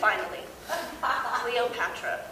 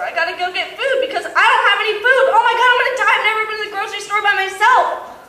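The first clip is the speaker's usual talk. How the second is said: louder, higher mock-frantic rush; hammed-up panic about shopping alone; 8 semitones higher, 7 dB louder, faster than usual. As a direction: ham up a tiny crisis. Mock panic about grocery store.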